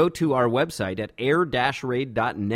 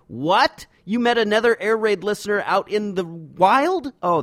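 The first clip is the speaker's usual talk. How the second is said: surprised; 9 semitones higher, 4 dB louder than usual. Real, not acted. Surprised by listener's story.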